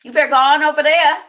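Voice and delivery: loud, high and fast; urgent urging. Real, not acted. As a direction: send the viewers off in a hurry. Urging viewers to another livestream.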